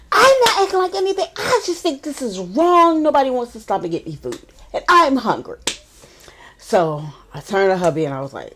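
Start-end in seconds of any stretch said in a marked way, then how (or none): 0.1-5.6 put-on high, loud, childish whine; whiny drunk friend. Friend whining to the car about food, high whiny pout. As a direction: pout and complain like a drunk brat.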